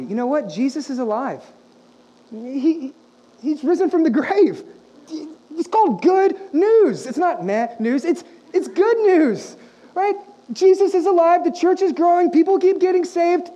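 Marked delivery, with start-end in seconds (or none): 2.3-13.5 higher, louder, slow proclamation of good news; joyful preaching. Acted. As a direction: preach it out to the congregation with full joy.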